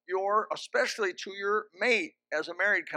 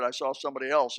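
ordinary talk, nothing marked

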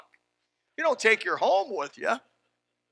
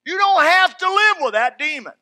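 higher, louder preacher's cry; full-force preaching; 8 semitones higher, 9 dB louder than usual. Acted. Preacher rallying the congregation against the demon of worry, loud and high. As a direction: drive it home from the pulpit.

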